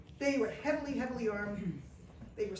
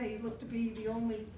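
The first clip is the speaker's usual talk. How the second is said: ordinary talk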